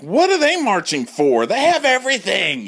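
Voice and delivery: high, loud indignant whine; jealous gays. Retold or imitated. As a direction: sneer at the marchers. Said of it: "the gays are like".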